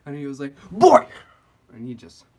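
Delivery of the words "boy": explosive high mock shout; imitated outburst, loud and high; going off. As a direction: yell it out in one loud, high burst. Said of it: "yo, he went off".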